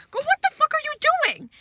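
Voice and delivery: high disbelieving cry; mock outrage at her nerve; sky-high exasperated girl; incredulous. Incredulous retold outburst.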